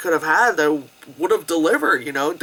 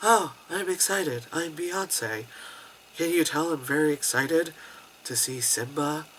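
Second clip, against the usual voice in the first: failed excitement; 2 semitones lower, 9 dB quieter than usual. Retold or imitated. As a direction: badly fake excitement in a soft, listless voice. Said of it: "try to sound excited".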